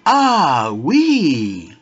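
high mock-excited "Quoi de neuf"; a lively retold excited hello; excited shout. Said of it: "she just shouted".

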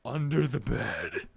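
low, slow and soft; eerie read. Acted. Reading a message in the game, low eerie voice. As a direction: read it slowly like a creepy message.

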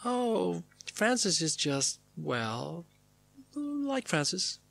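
high, drawn-out hesitant voice with long pauses; musing impression of Diane. Diane advising on meeting Francis, musing and halting. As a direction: hover vaguely between words, pitch swooping up. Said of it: "pauses, then says".

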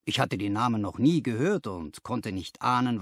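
plain reading voice

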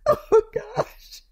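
breaking up with real laughter; too tickled to get words out; helpless laughter. Real, not acted. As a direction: gasp out words between laughs. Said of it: "the hardest I've ever laughed".

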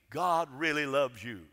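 normal talking voice

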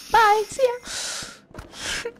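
slow high drawn-out bye; sing-song farewell, leaving friend; teasing goodbye. Pretend. Abandoning friend for content.

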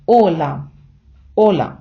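«Ола» with the о stressed, falling away after it; stress on first syllable. Shown as a demonstration. Teacher modelling the correct greeting, «Ола» stressed on the о. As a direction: hit the first vowel of «Ола», let the second drop. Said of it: "правильний наголос на «о»".

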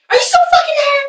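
very high, squealed question; hammed-up, high-pitched confrontation; mock outraged demand. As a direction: shoot up high on the accusation. Acted quarrel, confronting him.

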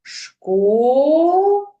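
"школа" loud and high, with a very long "о"; sustained rising "шко", then a long "ла"; drawn-out vowels. Shown as a demonstration. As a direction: dictate "школа" by syllables, stretching each vowel. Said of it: "протягуючи голосні звуки".

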